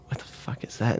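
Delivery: imitated under-the-breath bafflement, barely voiced; baffled mutter. Imitating a friend puzzled by the shopping list, baffled mutter. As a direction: mutter it softly, as if puzzling to yourself.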